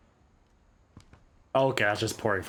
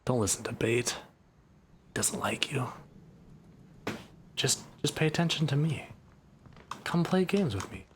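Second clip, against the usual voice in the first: tempting whisper; 4 dB quieter than usual. Pretend. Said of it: "Slander's voice is like honey".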